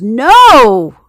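loud, high drawn-out cry of "No!"; full-voiced scream of protest; horrified yell. A narrator's reading. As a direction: yell it out long, rising high in alarm. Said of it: "and Bellotta yells".

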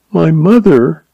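high, tentative start; timid. A narrator's reading. Girl starts to answer her stepfather, timidly. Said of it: "Jean began timidly".

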